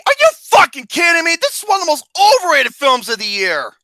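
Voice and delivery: high, loud indignant rant; can't believe this pick; outraged. Real, not acted. Outrage at the ranking.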